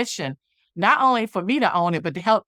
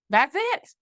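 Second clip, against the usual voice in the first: much higher, rising exclamation; retold revelation; 9 semitones higher than usual. Recalling the moment it clicked, high-pitched revelation. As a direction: lift it high as it suddenly clicks.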